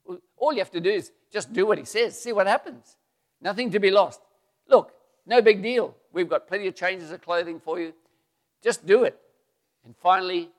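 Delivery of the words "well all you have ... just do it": higher, louder coaxing push; eager loud persuasion; loud urging. Acted. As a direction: soldiers pleading with their general, loudly. Soldiers urging Naaman.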